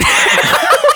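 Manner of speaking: high squealing laugh; burst of laughter. Real, not acted. Laughing hard at his own verbal slip. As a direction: crack up at the slip.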